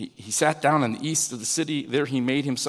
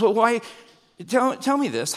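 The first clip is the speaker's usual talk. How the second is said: higher and louder, urging; pressing question; 7 semitones higher, 3 dB louder than usual. Retold or imitated. God pressing Jonah to explain himself, raised and urging. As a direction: lean in, press him for an answer. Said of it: "He goes".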